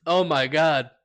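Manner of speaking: ordinary delivery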